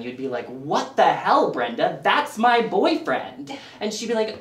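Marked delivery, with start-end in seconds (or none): 0.5-3.6 indignant, drawn-out confrontation; stretched-out, scandalized protest; mock outrage. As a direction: call out a friend in comic disbelief. Acted outrage at cheating friend.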